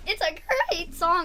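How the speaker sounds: imitating a cracking voice; squeaky mocking crack. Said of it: "What is with the voice cracks today?".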